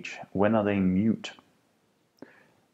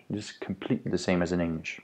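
normal talking voice

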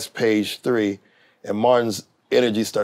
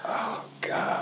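hushed, breathless "Oh, God"; barely voiced, bracing for trouble; whispered dread; 13 dB quieter than usual. Recalling being summoned to the star's office, hushed dread. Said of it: "I was like".

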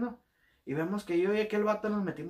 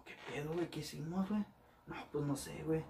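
softer nervous murmurs; hushed worried exchange; 8 dB quieter than usual. Retold or imitated. Two recruits asking each other in hushed voices.

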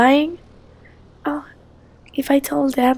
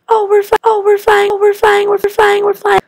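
high, loud chant of joy; child on the plane, overjoyed; thrilled kid; 7 semitones higher, 6 dB louder than usual. Acted. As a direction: squeal it over and over.

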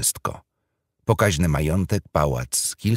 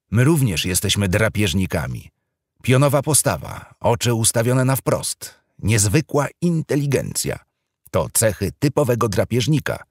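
grave documentary narrator, higher and louder; solemn TV documentary voice; serious TV presenter; 6 semitones higher, 3 dB louder than usual. Acted. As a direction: announce it like a solemn nature-show host. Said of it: "przemówił poważnym głosem speaker".

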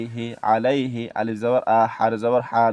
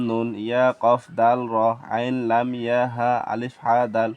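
usual speaking voice

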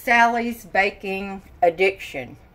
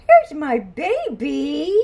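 ordinary talk, nothing marked

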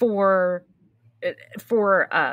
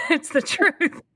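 higher-pitched, said through a laugh; laughing admission; 7 semitones higher, slower than usual. Real, not acted. Guest owning up to a long-winded answer, laughing. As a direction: let a giggle break into the words.

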